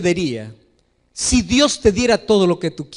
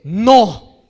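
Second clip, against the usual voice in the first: firm father's no; 5 dB louder than usual. Retold or imitated. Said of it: "si el padre es firme, le dice".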